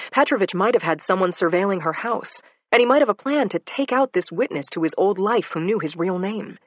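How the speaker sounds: plain reading voice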